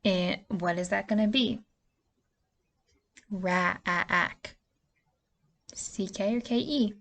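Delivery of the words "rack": Ra-a-ack with the short a drawn out; stretched vowel. Shown as a demonstration. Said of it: "Now think".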